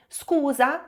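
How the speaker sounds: scusa said the Italian way, "skoo-za" with rising end. Shown as a demonstration. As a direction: say scusa with a lilting Italian rise. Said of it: "em italiano".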